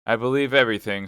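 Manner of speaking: loud, fast declaration; forced out through pain; hard certainty; bitter outburst. Acted. Athos grim outburst.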